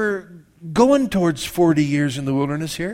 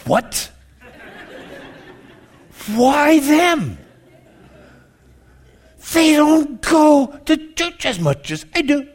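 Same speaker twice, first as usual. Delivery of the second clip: high, loud, slow jealous whine; 11 semitones higher, 4 dB louder, slower than usual. Pretend. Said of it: "Or do you go".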